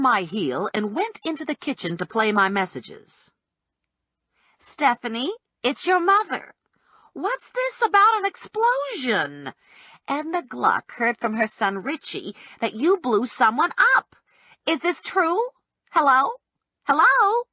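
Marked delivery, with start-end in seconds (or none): 4.6-15.4 mother on the phone. Acted. Mother's phone message voiced. 15.9-17.4 calling hello. Acted. Mother on machine calling out.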